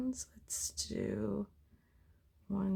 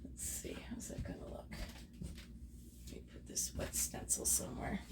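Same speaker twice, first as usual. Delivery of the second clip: soft unvoiced whisper; 11 dB quieter than usual. Real, not acted. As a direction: whisper it close to the mic. Painting vlog, whispered commentary.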